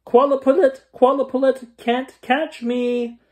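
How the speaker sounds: very high, loud sing-song taunt, slowing at the end; yelling as loud as she can. Acted. Girl dares the creatures at full voice, very high and loud. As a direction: shout it with everything she's got. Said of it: "yelled as loud as she could".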